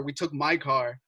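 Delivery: normal talking voice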